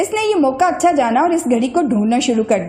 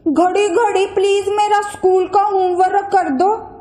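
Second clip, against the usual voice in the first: put-on higher, coaxing boy's plea; pleading boy; 6 semitones higher than usual. A narrator's reading. Saif begs the watch to do homework, coaxing. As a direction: wheedle the watch.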